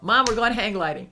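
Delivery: high and loud, bursting with excitement; excited announcement. Retold or imitated. Daughter excitedly telling her waiting mom, high and loud. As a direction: call it out thrilled. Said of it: "I went".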